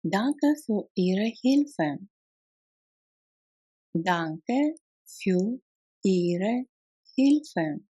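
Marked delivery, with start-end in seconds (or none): 3.9-7.8 slow, separated words. Shown as a demonstration. Teacher models thanks for help, word by word. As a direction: give each word its own space. Language drill, broken into words.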